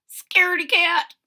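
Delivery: high, loud chirpy taunt; sing-song bird mockery; squeaky bird jeer. A narrator's reading. Birds taunting in story.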